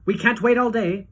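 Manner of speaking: loud, high hurry-up; impatient cry. A narrator's reading. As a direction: press them to hurry, loudly. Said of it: "cried Mister Wonka".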